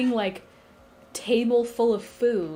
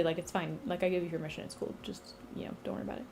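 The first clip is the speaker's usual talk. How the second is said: very soft offhand brush-off; casual reassurance; 4 semitones lower, 10 dB quieter, much faster than usual. Retold or imitated. Zeus and Gaia tell Hades it is fine, hushed and dismissive. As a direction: wave the worry away, fading almost to nothing.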